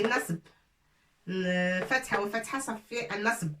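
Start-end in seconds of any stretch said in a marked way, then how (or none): none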